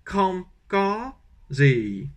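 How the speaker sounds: kram ka ze spelled out slowly, kram falling; slow Vietnamese demo. Shown as a demonstration. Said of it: "il faut dire".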